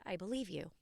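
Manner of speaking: soft, low, gentle; tender self-reassurance. Real, not acted. Talking gently to her anger in therapy, soft tender reassurance. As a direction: say it softly to your own angry self. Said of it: "I'm doing it right now".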